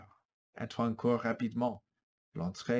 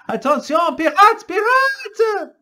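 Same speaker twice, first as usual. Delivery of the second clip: very loud, high warning yell; alarm shout; 15 dB louder, slower than usual. Acted. Spanish soldiers warning of pirates, loud. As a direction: scream the warning. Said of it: "Les Espagnols crient".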